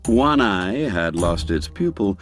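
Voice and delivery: ordinary delivery, nothing marked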